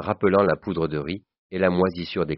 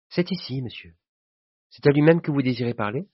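high-pitched polite maid; 8 semitones higher than usual. Acted. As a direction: answer the visitor in a high servant's voice. Maid answers the caller. (voix haute de bonne)

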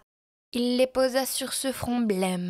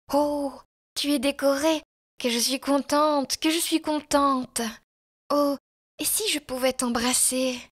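higher, overjoyed outcry; delighted exclamation; 5 semitones higher, 3 dB louder than usual. A narrator's reading. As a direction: cry out with joy at seeing the medal. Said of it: "elle s'écria". (exclamation de joie)

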